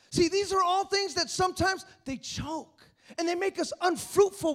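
loud, high preaching that drives every line home; fervent preacher rousing the congregation; full-force preaching. Acted. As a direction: preach it out hard to the whole room. Preacher builds again.

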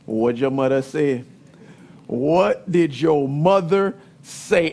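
a dad passing it back to mom; deflecting dad. Retold or imitated. Dad asking son what his mother said, low and knowing. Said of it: "And he would say".

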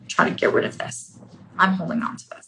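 breathy hushed insistence; whispered clinging. Acted. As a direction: whisper it like guarding a secret.

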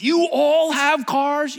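high, loud, drawn-out disbelief; a little girl's stunned cry; acted astonishment. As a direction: stretch it out in amazement.

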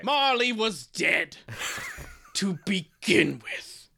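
slow, grand storybook narration; pretentious Dickensian reading. Pretend. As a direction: read it like pompous Victorian prose. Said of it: "get onto your pretentious and Dickensian note".

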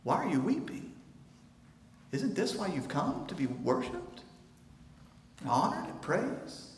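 soft, hushed questioning voice of Peter; a bewildered disciple, softly; imagined Peter asking. Retold or imitated. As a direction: ask gently, puzzled.

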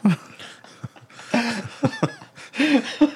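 high Irish lilt with every line rising like a question; sincere words made into questions, mock Irish; Irish accent. Friend keeps the bit going, questioning Irish lilt. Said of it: "Irish accent".

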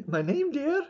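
put-on high old Scottish nanny voice; squeaky granny falsetto; Mrs Doubtfire. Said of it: "What was that impression of?".

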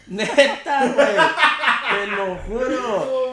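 laughing hard, high and loud. Real, not acted. Telling friends the crazy story, laughing loudly. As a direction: swear it through the laughter.

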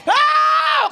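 long, high shouted "oh"; impassioned cry. Real, not acted. Preacher submitting his whole self, impassioned cry. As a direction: cry out loudly at full pitch.

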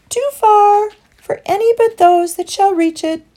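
very high, loud birdlike voice; fluting, high-pitched bird voice; cooing turtle dove. A narrator's reading. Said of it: "answered the turtle dove".

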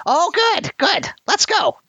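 very high, eager goblin cheer; a hungry goblin hurrying off; excited goblin. Acted. Goblin cheers about breakfast.

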